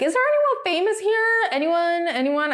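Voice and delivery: high-pitched mock search for a celebrity; imitating a hopeful call for anyone; calling out for someone. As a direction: call out to the crowd, pitch stepping down with each try. Imitates celebrity searching along the grid.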